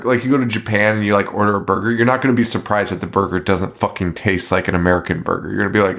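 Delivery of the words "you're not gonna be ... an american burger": ordinary talk, nothing marked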